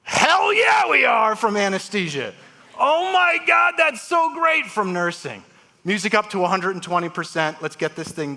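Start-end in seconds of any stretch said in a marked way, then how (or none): 0.0-1.4 loud, much higher whoop; cheering shout. Retold or imitated. Anesthesia team cheering the surgeon's plan, loud whooping shout. 2.8-4.7 loud, much higher thrilled cry; excited shout. Retold or imitated. Nurses thrilled at the surgeon's news, high excited shout.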